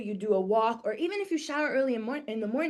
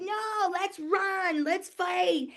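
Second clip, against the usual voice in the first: much higher, slow, alarmed cry; mock panic; 9 semitones higher, much slower than usual. Picnicker reacts to a thrown spear, in a hammed-up, much higher panicked cry. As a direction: play the startled caveman deciding to run or fight.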